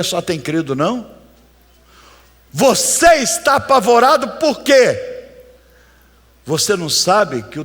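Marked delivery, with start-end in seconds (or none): 2.5-5.1 cobrança bradada. Acted. Pregador cobra o medroso.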